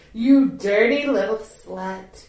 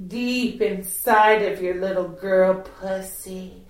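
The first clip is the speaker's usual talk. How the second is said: normal talking voice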